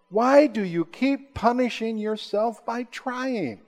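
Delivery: high, drawn-out needling question; mock taunting accuser. Satan to a struggling believer, slow high-pitched taunt.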